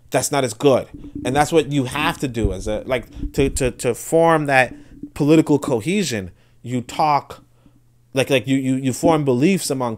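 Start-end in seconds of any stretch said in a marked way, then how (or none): none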